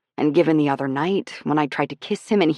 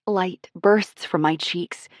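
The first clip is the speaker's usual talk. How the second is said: ordinary delivery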